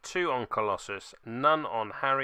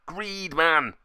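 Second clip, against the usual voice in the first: exasperated; 5 semitones higher, 4 dB louder than usual. Real, not acted. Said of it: "frustrated at the dice".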